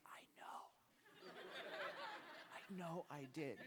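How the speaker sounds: whispered, laughing surrender; sheepish admission. Retold or imitated. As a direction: laugh it off under your breath. Admitting defeat at finish.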